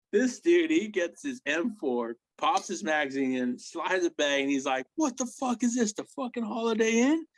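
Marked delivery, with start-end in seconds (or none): 4.9-7.3 much higher, indignant mock outrage; sarcastic bellow at the crowded tent; outraged buddy. Walt finding strangers on the bunks, high mock outrage.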